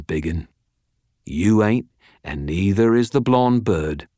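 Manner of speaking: lightly voiced cocky British tough; cocky crook, defiant. Prisoner calling his captors' bluff, cocky defiance. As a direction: lay it out defiantly, drawing out the key words.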